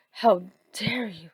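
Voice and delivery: hissed whisper of outrage; scolding whisper. Acted. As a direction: whisper the rebuke through the teeth. Wife scolding Kakashi.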